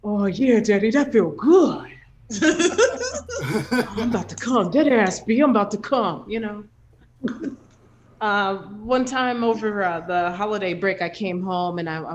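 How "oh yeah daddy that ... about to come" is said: loud hammed-up moaning man from the Bronx; mock bedroom voice. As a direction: ham up a buff Bronx dude's sex talk. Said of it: "sex sounds like".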